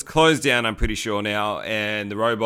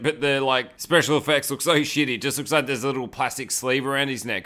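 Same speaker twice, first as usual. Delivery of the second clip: ordinary talk, nothing marked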